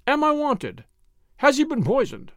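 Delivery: high-pitched, urgent questioning; urgent cry. A narrator's reading. Doctor bursting in at the window, crying out.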